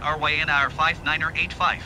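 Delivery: ordinary delivery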